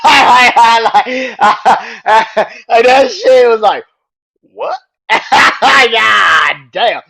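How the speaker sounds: shrieking with real laughter, high and loud; can't stop laughing at it, howling. Real, not acted. Fan losing it laughing at a wild promo, loud and high.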